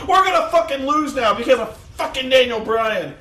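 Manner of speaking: high, loud mock rant; hammed-up angry panic; wanting to curse. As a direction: imitate a despairing, cursing commentator. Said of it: "he wanted to curse".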